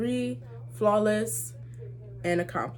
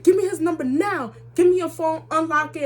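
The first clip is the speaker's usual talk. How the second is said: demanding mom; 8 semitones higher, 4 dB louder than usual. Retold or imitated. Acting mom demanding the phone.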